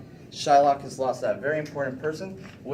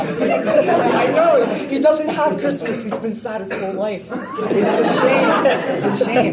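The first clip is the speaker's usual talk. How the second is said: loud, high-pitched comic lament; impassioned outburst; 10 semitones higher, 11 dB louder, slower than usual. Acted. Presenter defending sad Shylock to hecklers, loud hammed-up wail. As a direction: cry out in over-the-top protest.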